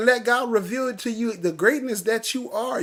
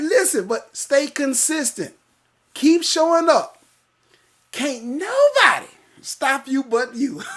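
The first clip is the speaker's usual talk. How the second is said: high, slow, drawn-out exhortation; loud, rising pulpit delivery; fired-up preaching; 4 semitones higher, 5 dB louder than usual. Real, not acted. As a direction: stretch and punch each phrase like a preacher. Preaches a comment at full force.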